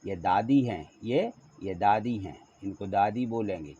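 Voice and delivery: ordinary talk, nothing marked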